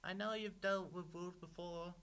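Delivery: high, loud frightened piglet; pig crying out. A narrator's reading. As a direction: wail the plea high as the pig. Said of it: "Pig cried".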